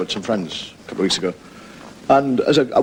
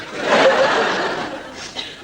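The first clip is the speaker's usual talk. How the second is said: laugh. Real, not acted. Laughs after the impression.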